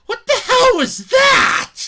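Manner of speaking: stunned slow shout. Real, not acted. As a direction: bellow it out in disbelief. Ranting reviewer reacts to clip.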